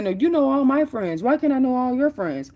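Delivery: high, rising accusing wife's voice; wife's indignant accusation. Retold or imitated. Jealous wife grilling her husband on the phone, in a high indignant voice.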